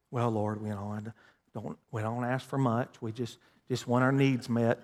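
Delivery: low, soft, meek mock prayer; falsely humble. Preacher mimics believer asking God for little, falsely humble. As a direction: shrink into timid, apologetic begging. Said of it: "That sounds humble".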